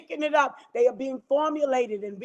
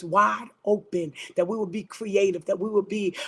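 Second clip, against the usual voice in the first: lower, earnest declaring prayer voice; 4 semitones lower than usual. Acted. Praying over the listeners, declaring and decreeing.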